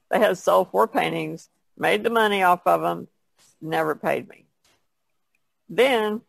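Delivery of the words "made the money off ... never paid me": ordinary talk, nothing marked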